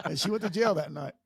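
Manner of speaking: ordinary talk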